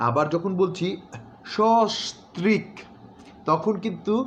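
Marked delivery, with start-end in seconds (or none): 1.5-2.7 সস্ত্রীক slow, first স said as শ; slow pronunciation demo. Shown as a demonstration. Teacher slowly modelling সস্ত্রীক with a sh sound. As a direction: draw out সস্ত্রীক as shostrik. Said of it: "তালব্য স দিয়ে".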